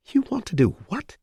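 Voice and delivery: high, incredulous outburst; stunned, pitch leaping up; shocked disbelief. Acted. Shocked hostage reaction.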